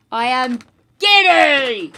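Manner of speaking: loud, drawn-out burst on "giddy"; emphatic giddy. Real, not acted. Streamer repeating their delight to viewers, loud giddy outburst. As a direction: hold back, then let "giddy" burst out slowly.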